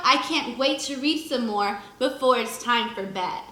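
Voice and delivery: ordinary talk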